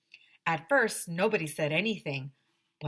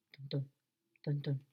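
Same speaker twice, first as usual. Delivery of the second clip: low soft dun dun; imitated heartbeat; 5 semitones lower, 6 dB quieter than usual. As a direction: tap out a heartbeat softly.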